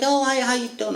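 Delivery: lightly voiced sponsor lady, bright and high; cheerful greeting. Lady answering his call, a light high cheerful hello.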